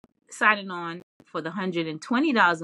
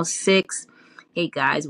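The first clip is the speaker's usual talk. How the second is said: ordinary delivery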